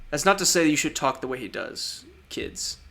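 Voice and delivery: normal talking voice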